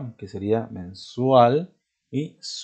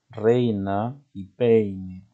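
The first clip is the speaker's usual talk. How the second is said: slow dictation; much slower than usual. Shown as a demonstration. Teacher dictating example words.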